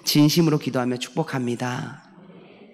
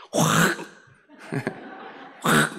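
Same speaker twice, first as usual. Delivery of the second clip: Gyeongsang threat; 13 semitones lower, 4 dB quieter, slower than usual. Shown as a demonstration. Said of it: "라면, 그죠?".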